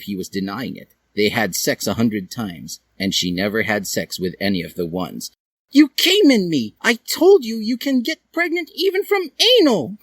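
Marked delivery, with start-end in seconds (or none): 5.7-10.0 put-on high, loud accusing girl; high female voice. A narrator's reading. Dally accusing Hiei.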